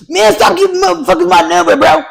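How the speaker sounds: loud mock scolding down the phone; irritated prank-call victim. Prank-call victim tells the caller off, loud and irritated.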